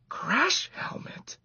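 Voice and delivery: slow, high breathy disbelief; incredulous whisper. A narrator's reading. Boy amused at a mouse's helmet, incredulous whisper. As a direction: whisper it half-laughing at the idea.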